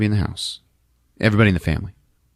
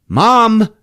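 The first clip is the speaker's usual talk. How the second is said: high, loud shout to get attention; calling louder; 8 dB louder than usual. Retold or imitated. Peter to his sleepwalking mom, shouting. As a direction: raise it sharply into a shout.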